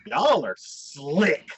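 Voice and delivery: very slow, stretched-out words; drawn-out reaction. Retold or imitated. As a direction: savour each word in mock amazement. Her amazed reaction to the twist.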